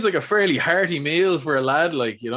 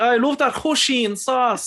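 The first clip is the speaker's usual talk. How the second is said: put-on Irish accent, higher and slow, "hoisin" said as HOO-shin; mock Irish lad saying "hoisin" as HOO-shin, pitched up and drawn out; Irish mate's hoisin; 10 semitones higher, slower than usual. Imitating his Irish mate ordering food, slow high Irish accent saying HOO-shin. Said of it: "my mate calls it hoisin sauce".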